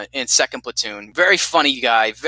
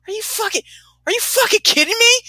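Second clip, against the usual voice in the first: high-pitched exasperated disbelief; incredulous disbelief. Retold or imitated. At his buddy in the hotel room, high incredulous outrage. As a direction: squeal it in outrage.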